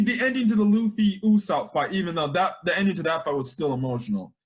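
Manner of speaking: ordinary talk, nothing marked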